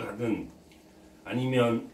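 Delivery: talking with mouth partly full; words muffled by chewing; speaking while eating. Real, not acted. Seen 0: hand brings something to lips, then looks down at pan.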